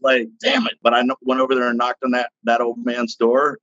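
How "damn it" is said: high-pitched mock exasperated curse; retold exasperation. Retold frustrated reaction to bad news.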